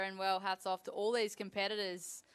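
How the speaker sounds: ordinary talk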